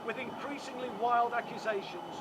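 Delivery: measured public statement read aloud; formal legal reading. Acted. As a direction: deliver it like a prepared press statement. Reads prepared statement to press.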